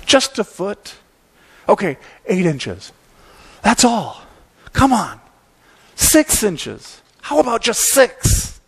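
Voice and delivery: put-on high little-boy bargaining; Calvin pleading. Calvin bargains with God over inches of snow, high wheedling plea. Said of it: "He's offering prayer".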